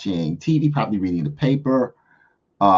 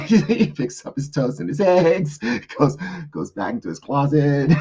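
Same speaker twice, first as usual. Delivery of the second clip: ordinary talk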